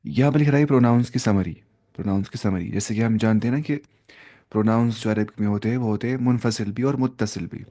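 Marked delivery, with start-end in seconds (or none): none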